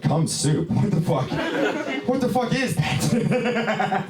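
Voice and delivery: rising disgusted shock, up high and slow at the end; shocked kid. Retold or imitated. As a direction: recoil at the taste, voice climbing. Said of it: "You're like".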